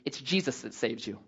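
normal talking voice

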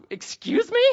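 incredulous, half-laughing disbelief; shocked disbelief. Retold or imitated. Pastor hearing the meth rumor, mock shocked.

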